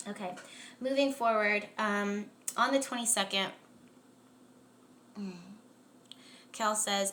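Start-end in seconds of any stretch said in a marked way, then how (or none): none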